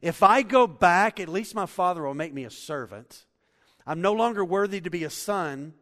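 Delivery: a desperate son begging to come home; the son's plea. Acted. Prodigal son begging his father to take him back, pleading loudly.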